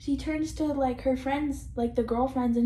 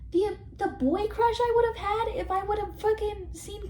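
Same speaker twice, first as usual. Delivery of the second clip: excited gushing; 7 semitones higher than usual. Real, not acted. Gushing about Ken.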